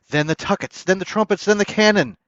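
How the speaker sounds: from loud to a ringing shout, pitch climbing each line; rising, swelling proclamation; building fanfare. A narrator's reading.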